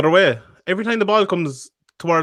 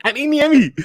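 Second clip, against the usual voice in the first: Scottish accent try; 10 semitones higher than usual. Shown as a demonstration. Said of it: "I can't do it".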